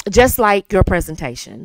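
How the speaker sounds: ordinary delivery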